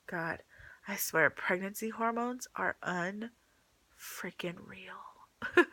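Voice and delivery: choked whisper, holding back crying; barely voiced, fighting tears; whispering through tears. Real, not acted. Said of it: "I'm trying not to just, like, cry right now".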